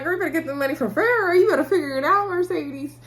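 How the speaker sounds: high, fast, sassy scolding; sassy warning. Real, not acted. Vlogger scolds Mercedes on screen, high sassy and fast. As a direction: warn her with big attitude.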